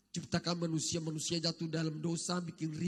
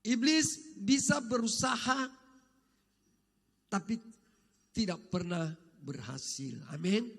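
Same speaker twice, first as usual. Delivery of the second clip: preaching; 3 semitones higher, 4 dB louder than usual. Acted. Preaching at full force.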